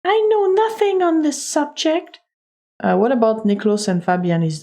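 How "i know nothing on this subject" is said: high, haughty lady's voice; arrogant lady. Acted. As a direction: refuse the inspector with high-pitched arrogance. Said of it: "arrogant voice, 'cause now she doesn't like me".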